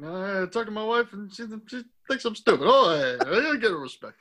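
put-upon comedian impression; muttered, jittery catchphrase griping; Rodney Dangerfield. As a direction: complain like a hard-done-by stand-up. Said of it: "that's a pretty good Rodney".

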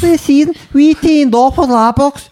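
very high, squeaky Scouse; hammed-up shrieky Liverpudlian; mock Scouse accent. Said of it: "Can you do Scouse?".

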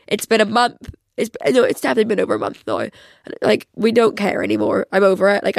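usual speaking voice

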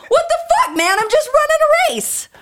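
very high, loud mock-outraged protest; indignant shriek. Runner answering the screaming deer, indignant mock shriek. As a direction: shriek back as if wrongly accused.

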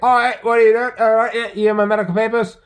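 very high, loud Aussie confrontation; Aussie Cossack. Retold or imitated. Host mimicking YouTuber challenging a cop, loud and combative. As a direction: challenge the officer at full volume. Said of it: "he's the Aussie Cossack".

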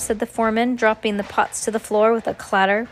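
ordinary delivery, nothing marked

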